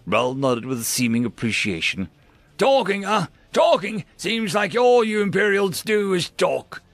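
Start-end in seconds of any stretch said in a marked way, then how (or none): 2.6-6.8 high, raised-voice incredulity; angry scorn. Acted. Grice scorns the Imperial prisoner's excuse, angrily. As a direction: throw the excuse back at her.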